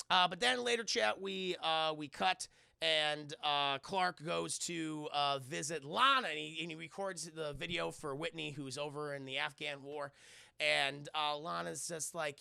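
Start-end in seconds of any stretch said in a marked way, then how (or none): none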